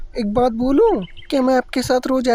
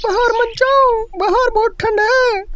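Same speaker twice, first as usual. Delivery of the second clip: high-pitched motherly voice, gently warning; a mother calling her little girl back from the cold; caring mother; 10 semitones higher than usual. Acted. Mother calls daughter Kavita in, high and caring.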